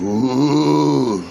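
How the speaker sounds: a drawn-out mock crowd "Ooh". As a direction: imitate the onlookers reacting in a chorus. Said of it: "everybody goes".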